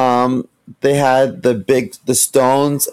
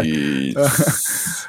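mock Vader please; 7 semitones lower, 6 dB quieter than usual. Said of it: "Imagine that guy".

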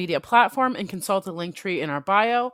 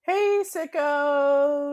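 slow, drawn-out, higher greeting; sing-song greeting; 8 semitones higher, 4 dB louder, much slower than usual. Real, not acted. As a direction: stretch it out like a show opener. Podcast intro call.

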